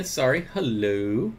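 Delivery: ordinary delivery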